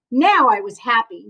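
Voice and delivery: plain reading voice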